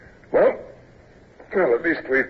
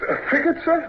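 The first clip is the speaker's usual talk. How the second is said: surprised. Acted. Offered a transfer to a frigate.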